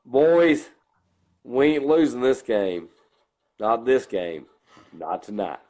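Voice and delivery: deep low coach voice. Shown as a demonstration. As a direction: go down into a deep low growl of resolve. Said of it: "and I do it like this".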